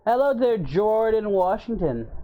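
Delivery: high, slow cartoon hero greeting; Goku voice. Greeting chat member in Goku voice.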